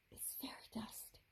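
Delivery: hushed, awed whisper. Acted. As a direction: breathe it out in a whisper. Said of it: "Rachel whispered".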